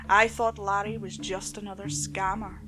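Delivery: plain reading voice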